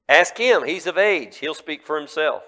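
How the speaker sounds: loud, high ask him; parents pushing it back. Acted. Parents deflecting questioners, loud and sharp. Parents voiced in retold story.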